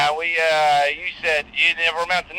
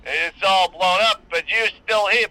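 ordinary talk

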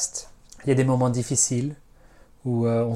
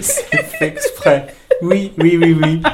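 laughing; 3 semitones higher, 7 dB louder, slower than usual. Real, not acted. Laughing at own pun.

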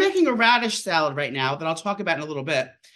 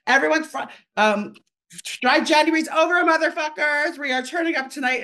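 excited; 6 semitones higher than usual. Retold or imitated. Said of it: "I text her, I said".

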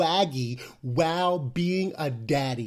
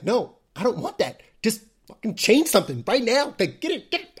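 voicing a fed-up baby, higher and urgent; demanding baby; 6 semitones higher than usual. Baby daughter refusing cuddles and demanding change, mock-demanding. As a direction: imitate an impatient baby's demands. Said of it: "And she's like".

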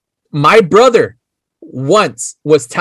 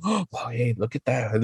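put-on much softer, hushed excited gasp of boys in class; 6 semitones lower, 14 dB quieter than usual. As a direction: whisper it like you must not be caught. Kids seeing boobs in class.

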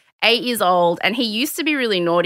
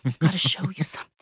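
hushed, whispered confession; secretive toddler whisper; really quiet child; 6 semitones lower, 7 dB quieter, faster than usual. Retold or imitated. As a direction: whisper it like a little kid with a secret. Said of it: "comes up to me really quiet".

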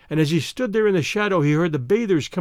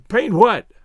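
loud, raised-pitch question; a blunt, raised-voice query; 5 semitones higher, 6 dB louder than usual. A narrator's reading. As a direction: fire the question back loudly.

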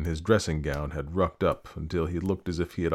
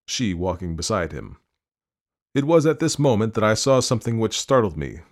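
ordinary delivery

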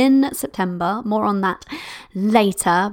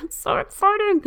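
giggly excitement; 9 semitones higher than usual. Real, not acted. Gushing about her new mastermind.

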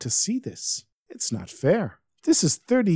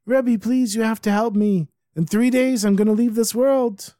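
tearful pleading; 9 semitones higher than usual. Acted. Fivish at the Baal Shem Tov's feet, high tearful begging. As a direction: plead as if crying, pitched way up. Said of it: "he starts crying".

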